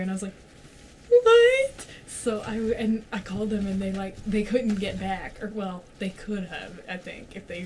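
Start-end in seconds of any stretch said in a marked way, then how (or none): 1.1-1.9 very high, loud squeal of disbelief; stunned shriek. Retold or imitated. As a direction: shriek "What?" in pure shock. Shocked reaction in retold scene.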